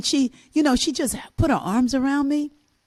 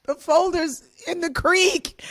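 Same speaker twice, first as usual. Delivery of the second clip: sobbing plea; 8 semitones higher, slower than usual. Retold or imitated. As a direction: cry out the words as a distraught child. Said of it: "all I could say was".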